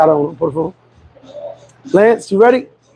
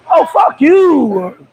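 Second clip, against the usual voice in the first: slow, high drawn-out shout; shocked shout; 6 semitones higher, much slower than usual. Real, not acted. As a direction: recoil and yell it out slow.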